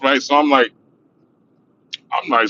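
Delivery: normal talking voice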